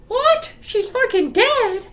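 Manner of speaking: high, loud squeal of disbelief; mock shock. Reacting to the girl's death, shrieky high mock surprise. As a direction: squeak it in hammed-up astonishment.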